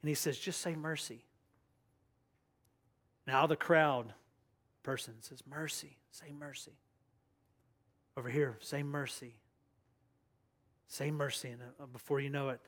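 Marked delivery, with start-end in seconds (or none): 0.4-1.2 soft, hushed urging. Acted. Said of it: "everybody got quiet". 5.5-6.8 very soft lone voice from a crowd; barely audible, hushed plea. Acted. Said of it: "everybody got quiet". 8.6-11.5 soft plea from the crowd; hushed. Acted. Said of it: "everybody got quiet".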